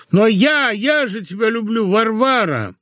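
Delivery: high-pitched pleading declaration of love; impassioned plea. A narrator's reading. Husband declares love to his departing wife, pleading passionately.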